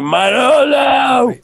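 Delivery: high, loud, drawn-out yell; mock fan yell. Crazed fan grabbing the actor's arm, high stretched yell.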